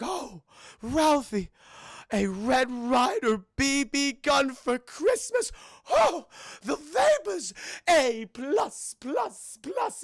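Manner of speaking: high, drawn-out gushing teacher voice; swooning teacher. Acted. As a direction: gush slowly over a pupil's essay. Imagined teacher swooning over essay.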